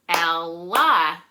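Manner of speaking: Ella in two loud, separate beats; clap-along Ella, one strong beat per syllable. Shown as a demonstration. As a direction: split Ella into El and la, both punched. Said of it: "two syllables".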